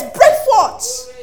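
high and loud, ringing cry; preaching at full force. Acted. Pastor rousing congregation, high loud cry. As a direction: throw it out to the whole church.

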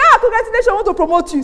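loud, high cheerful boss; beaming congratulations. Retold or imitated. CEO telling the trembling worker he is promoted, loud and cheerful. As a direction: announce the promotion brightly.

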